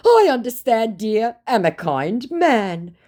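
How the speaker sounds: high, slow put-on voice; syrupy fake kindness; mock kind scammer. Scammer reassuring her in a high slow mock voice.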